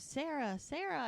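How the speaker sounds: very high mock call, no real volume; calling after her. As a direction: imitate calling to someone disappearing into crowds. Retold man calling as she leaves.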